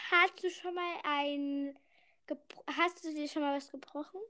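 ordinary delivery

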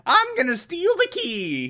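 very high, hammed-up villain; Joker. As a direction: announce the scheme in a high cartoon-villain pitch. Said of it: "the Joker shows up and is like".

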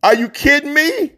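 high disbelieving outburst; incredulous. Real, not acted. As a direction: ask in stunned disbelief. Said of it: "incredulous outburst".

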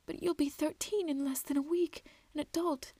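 tearful mother. A narrator's reading. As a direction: speak through held-back tears. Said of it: "She was weeping".

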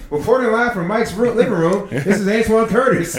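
loud, high news reporter voice. Pretend. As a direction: report it live like a TV newsman. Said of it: "I was gonna do my reporter voice".

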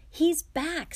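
high, loud call to his brothers; excited call. Acted. George hears the door slam and calls out high and loud. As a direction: announce it to the whole garden.

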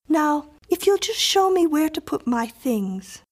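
put-on high, presumptuous little-girl voice; child settling in. A narrator's reading. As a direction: ask as a child who assumes she is welcome. Miriam settles in.

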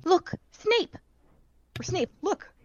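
urgent alarm. Acted. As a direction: point it out in alarm. Hermione alarmed, pointing.

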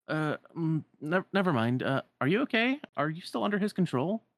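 stammer, then ask with rising concern; flustered, concerned young hero. Acted. Voices Sora questioning Riku.

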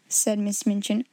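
plain reading voice

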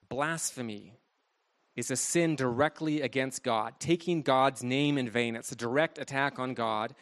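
normal talking voice

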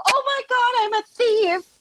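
high-pitched, hammed-up wail of horror; mock tearful panic. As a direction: squeal it out in exaggerated distress. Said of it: "I, like, start crying".

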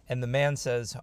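ordinary talk

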